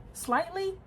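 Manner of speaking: high disbelieving rise on slightly; mock questioning. Real, not acted. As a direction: ask slightly back at yourself. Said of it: "Ever so".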